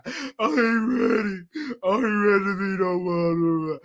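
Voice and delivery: hammed-up sobbing wail; big ugly cry. Imitating their crying to mom about not being ready, ugly cry. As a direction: drag the words out in mock bawling. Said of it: "I got that big ugly cry".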